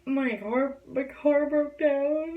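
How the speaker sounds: high, slow mock sob; retold bawling. Driver tells cop through tears why she's upset, teary high voice. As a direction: whimper it like a crying kid. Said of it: "I start bawling my eyes out".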